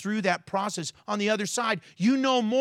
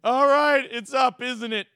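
mock resigned plea; 3 semitones higher than usual. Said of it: "I'm like".

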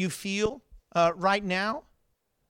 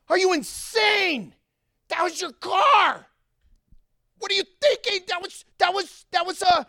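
incredulous shout; 13 semitones higher, 6 dB louder than usual. Retold or imitated. Man shouts at the owner who let his car fall, outraged yell. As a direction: yell in disbelief at the stranger. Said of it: "He's like".

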